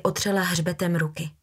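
usual speaking voice

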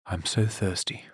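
soft, drowsy murmur; fading into sleepy longing. A narrator's reading. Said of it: "she thought drowsily".

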